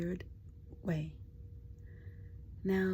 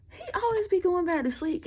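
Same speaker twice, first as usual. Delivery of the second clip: exasperated complaint; 10 semitones higher, 6 dB louder than usual. Real, not acted.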